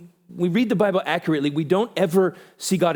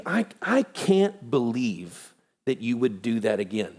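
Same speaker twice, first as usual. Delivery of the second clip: low, slow, weary disappointment; mock disappointed scolding; 6 semitones lower, 3 dB quieter, slower than usual. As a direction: act out a disappointed parent, slowly. Acted God scolding someone for repeating.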